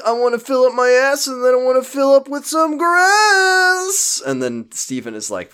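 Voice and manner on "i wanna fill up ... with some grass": high, loud goofy sing-song; silly singsong. Pretend. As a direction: belt it out like a cartoon.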